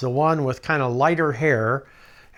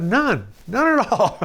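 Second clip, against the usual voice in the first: mock casual denial; 8 semitones higher, 4 dB louder than usual. Answering while jerking along.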